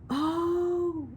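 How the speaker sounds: high, drawn-out sigh of wonder; breathed "oh". A narrator's reading. Janie hearing he's an orphan, breathed in sympathy. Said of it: "breathed Janie".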